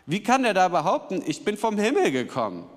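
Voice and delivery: high, indignant crowd protest. Acted. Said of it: "riefen sie empört". (empört und ungläubig)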